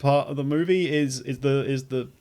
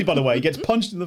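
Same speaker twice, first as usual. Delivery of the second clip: normal talking voice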